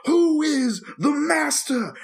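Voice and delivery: high, slow, drawn-out villain taunt; movie villain taunt. Retold or imitated. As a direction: stretch every word like a gloating villain. Retold movie villain taunting hero.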